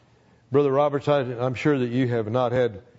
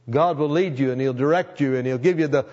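fervent preaching; 6 semitones higher than usual. Acted. Preacher urging the congregation, building to full force.

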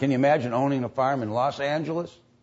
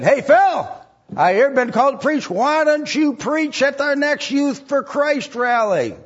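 eager invitation; 13 semitones higher, 4 dB louder than usual. Retold or imitated. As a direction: call out eagerly to Phil. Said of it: "they said".

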